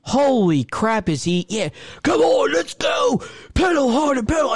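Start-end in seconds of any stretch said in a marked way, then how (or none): 2.0-4.5 high mock shout of a cycling coach; screaming workout instructor. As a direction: yell it like an over-the-top spin instructor. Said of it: "someone to scream at you".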